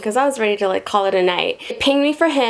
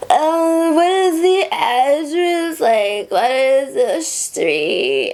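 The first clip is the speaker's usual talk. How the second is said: put-on slow, higher slurred drunk voice; drunk passenger; 4 semitones higher, much slower than usual. Drunk passenger asking his friend for the street, slow slurred drunk voice. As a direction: drag it out like a wasted guy.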